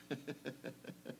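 long, hushed wheezy chuckle; quiet chuckle. Real, not acted. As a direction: laugh softly under the breath. Chuckles after quoting the elder.

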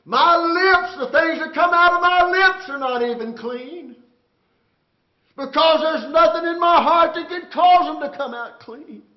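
Isaiah crying out high and loud, slow and stretched; anguished cry. Acted. Preacher voicing Isaiah undone before God, anguished shout.

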